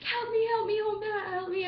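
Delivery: high pleading for help; high hammed-up plea; mock pleading. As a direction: beg the boys for help, high. Begging guy friends for help.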